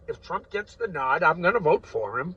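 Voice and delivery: loud rant. Real, not acted. Ranting about voting for Trump.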